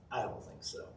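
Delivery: put-on a child's quiet whispered "I don't think so"; whispered retort. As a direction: hush it like a knowing little girl. Daughter voiced in retold story.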